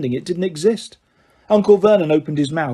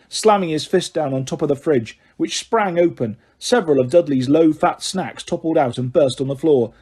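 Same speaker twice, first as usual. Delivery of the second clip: ordinary delivery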